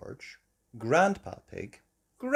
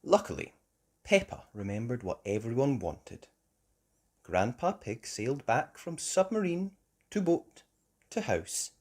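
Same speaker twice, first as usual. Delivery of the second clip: ordinary delivery, nothing marked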